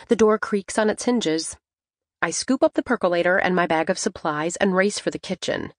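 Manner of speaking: ordinary delivery, nothing marked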